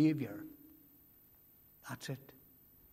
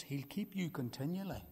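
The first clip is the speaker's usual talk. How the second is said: soft first statement; 5 dB quieter than usual. Acted. Preacher gently tells his congregation, softly. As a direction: say it quietly, almost to yourself. Repeats line, quiet then lifted.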